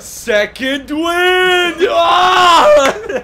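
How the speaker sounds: high, loud, drawn-out war cry; triumphant battle yell. Acted. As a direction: holler as if bursting back to life. Mock burst of new energy.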